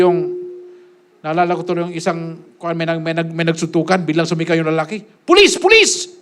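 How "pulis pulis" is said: high frantic cry of "Pulis!"; a man crying out for help in a fight; calling for police. Retold or imitated. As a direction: yell for the police in a panic. Retold man shouting for police.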